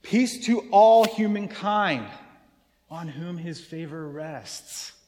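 "Peace to all humankind" loud and slow, then drop; the reading for all humankind; slow inclusive reading. Shown as a demonstration. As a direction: spread the peace over all, slowly. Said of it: "Do you see the difference?".

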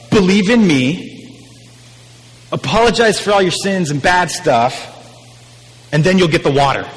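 a lightly voiced preachy Jesus.

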